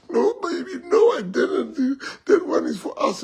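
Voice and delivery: high, tearful mock denial; pleading husband. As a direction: whine reassurance as the cheating husband.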